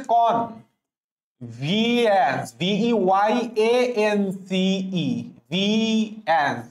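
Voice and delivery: ভিএন্স said very slowly, then spelled; teacher models ভিএন্স slowly; slow pronunciation. Shown as a demonstration. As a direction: sound out ভিএন্স carefully for the class. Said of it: "তুমি এভাবে পড়বা".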